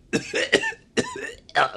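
a high, retching gag sound; demo retching. Shown as a demonstration. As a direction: show how hard you retched. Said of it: "like that".

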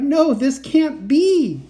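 high, loud, drawn-out denial; horrified cry. A narrator's reading. Kat overwhelmed by copies of uncle, horrified cry. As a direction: wail it slowly in disbelief. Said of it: "I cried".

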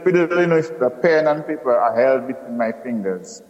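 ordinary delivery

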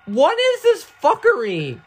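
very high, loud, drawn-out shriek; outraged shriek. Real, not acted. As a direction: shriek it slowly in disbelief. Attacked in game.

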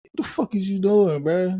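high, heated callout at a smoker; mock scolding him to put it out; retold callout. Man on train told to stop smoking, high heated scolding. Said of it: "I'm like".

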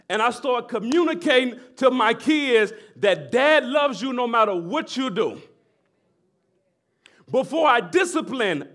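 loud, high, impassioned preacher; full-force preaching. Acted. Pastor to church, fervent shouted preaching. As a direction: preach it out to the congregation.

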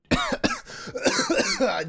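harsh choking cough; coughing fit. Real, not acted. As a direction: cough hard, something went down wrong. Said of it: "What's going on?".

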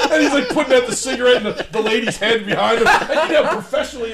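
high and loud, words through real laughter; can barely get it out for laughing; laughing while talking. Real, not acted. Telling a friend's wild story while laughing.

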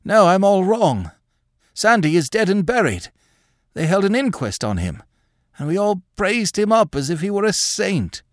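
high-pitched, bewildered protest; rattled old man insisting; agitated confused old man. Acted. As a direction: correct yourself in a high, fretful voice.

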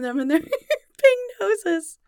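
high, loud, laughing so the words break; laughing through words. Real, not acted. Host tickled by monkeys with giant noses, laughing as they speak. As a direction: crack up while saying it.

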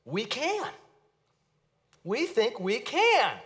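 a hint of swaggering overconfidence; arrogant disciples. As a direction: play the boastful disciples with a smug lift. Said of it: "most arrogant responses".

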